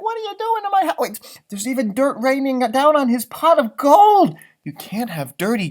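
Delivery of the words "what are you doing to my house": high, indignant shout of a tiny leprechaun; a little man yelling up at the intruder; angry leprechaun. Acted. Leprechaun yelling at the digging paws, high and angry. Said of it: "Mackenzie is like".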